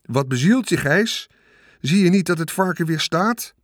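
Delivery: understated angry roar; bellowing. A narrator's reading. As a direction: scold him in exasperation. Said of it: "bulderde meneer Hartsuiker".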